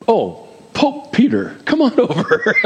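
playful call dissolving into a laugh; joking mock summons. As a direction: crack up while calling someone over.

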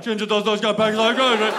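fast, loud British chant; British crowd jeering. Acted. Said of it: "the whole store is like".

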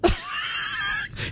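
high, breathy, unvoiced cackle; host bursting out laughing at cohost's joke; burst of laughter. Real, not acted. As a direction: crack up helplessly.

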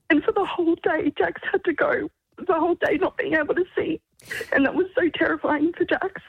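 real tearful telling in a high voice; emotional, crying. Real, not acted. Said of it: "I'm getting so emotional".

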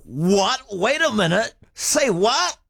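put-on puzzled double take at the accent; confused surprise. Hearing a Samoan speak English, baffled. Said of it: "I'm like".

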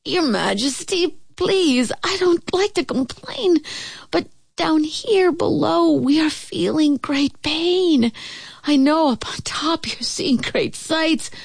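high, pitiful pleading voice; small, suffering underling begging for relief; plaintive squeaky complaint; groaning little turtle. A narrator's reading. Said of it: "groaned Mac".

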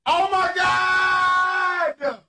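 high, drawn-out squeal of excitement; ecstatic scream. Real, not acted. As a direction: stretch it out in a high, thrilled cry. Raving about favourite rapper's concert.

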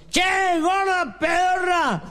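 high, drawn-out shout up to a balcony; calling up. Acted. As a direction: yell it upward, high and slow, as a joke drunk. Borracho grita hacia el balcón. (grito agudo y alargado hacia el balcón)

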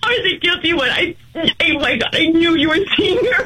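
ordinary talk

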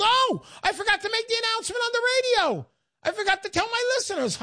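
high, fast burst of surprise; suddenly remembering something important. Real, not acted. Host suddenly remembers announcement.

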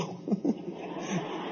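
a quick airy chuckle; breathy laugh. Real, not acted. Preacher laughing mid-quote, airy and breathless.

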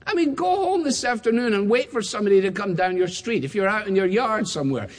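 ordinary delivery

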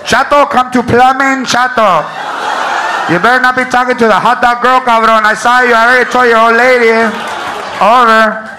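loud Chicano intercom call, teasing. Pretend. The announcer teases coworker Chato over the store intercom, loud Chicano accent. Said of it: "announcements have accents".

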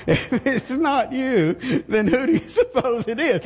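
high, laughing through the words; can't hold back the laugh while retelling. Real, not acted. Preacher retelling the wife's retort, laughing high.

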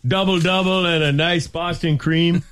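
high, hammy Canadian "eh"; hammed-up Canadian accent. Joking about a Tim Hortons death, hammy Canadian accent. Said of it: "As a Canadian?".

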